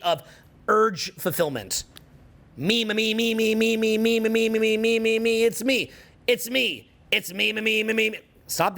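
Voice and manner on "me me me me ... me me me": rapid monotone me-me-me chant, slightly raised pitch; needy, repetitive me-me-me drone; mock self-absorption. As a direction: rattle it off nonstop, mocking self-obsession. Mocks self-absorbed chatter, then "stop that.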